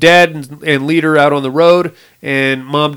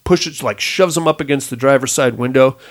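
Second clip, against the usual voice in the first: normal talking voice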